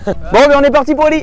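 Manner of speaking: laughing hard through the line. Real, not acted. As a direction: burst out laughing. Laughing at a joke.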